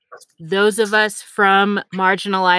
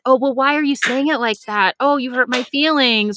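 higher-pitched mock grievance; mock complainer; 5 semitones higher than usual. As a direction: lightly imitate a hurt objector, pitched higher.

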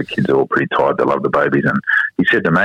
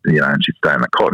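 usual speaking voice

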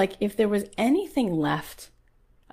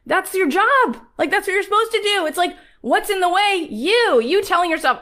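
high, loud fired-up preaching; rally the crowd like a preacher; preaching at full force; 9 semitones higher, 5 dB louder than usual. Acted. Preaching to her audience.

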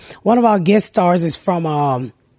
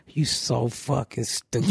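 low murmured put-down under the breath; whispered insult; 9 semitones lower, 11 dB quieter, slower than usual. Retold or imitated. Dad whispering an insult on set, low murmur. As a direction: mutter it low and slow. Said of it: "Daddy whispered".

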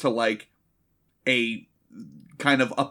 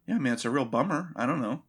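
unbothered shrug; 2 semitones lower, 5 dB quieter than usual. Acted. Johnny Cage answering the taunts, unbothered shrug. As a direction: mumble it with a mild shrug. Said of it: "he didn't get the memo that day".

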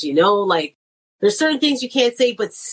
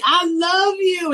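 gushing mom; 10 semitones higher, much slower than usual. Retold or imitated. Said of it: "And I'm like".

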